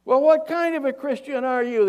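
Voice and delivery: high, loud acted holler; full-voiced long-distance call; yelling up. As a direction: shout the question up to someone far above. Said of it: "He yells up at him".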